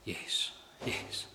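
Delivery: frail, whispered assent; weak. Acted. Sick Livingstone answering Stanley, weak whisper. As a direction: barely get the words out, very softly. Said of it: "with the weakness in his body".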